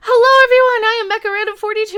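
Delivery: loud, bright hello to the viewers; big excited greeting. Real, not acted. Greeting her YouTube viewers, loud and excited. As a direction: launch into the show with energy.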